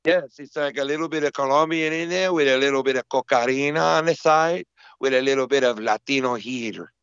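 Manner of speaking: put-on extra Colombian accent. Co-host describing his own accent, in exaggerated Colombian. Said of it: "We sound extra Colombian now".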